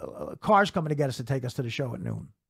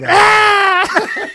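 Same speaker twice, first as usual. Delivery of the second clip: high, loud, drawn-out shout through laughter; shouted laughing insult; 14 dB louder than usual. Retold or imitated. Comic replaying friend's roast insult, laughing shout. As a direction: bellow the insult slowly, cracking up.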